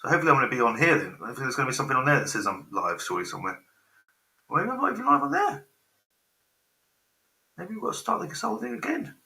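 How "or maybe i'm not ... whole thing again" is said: much higher, squeaky puzzled musing; thin, high-pitched put-on voice. Real, not acted. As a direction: wonder aloud in a falsetto squeak. Baffled by stream, puts on squeak.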